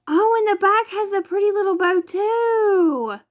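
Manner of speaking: high-pitched happy squeal; delighted surprise. Real, not acted. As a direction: squeal at a lovely discovery. Delighted discovery on the back.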